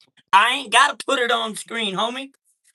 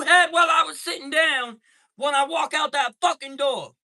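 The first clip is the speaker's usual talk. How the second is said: heated shout; 8 semitones higher than usual. Retold or imitated. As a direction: bark it at him like a street challenge. Retelling confronting the neighbour at door.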